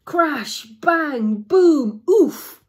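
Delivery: high, loud, punched one by one; dramatic storybook sound effects; exclaimed crash words. Acted. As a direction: hit each impact like a comic-book bang. Acted sound words of the snowball crash.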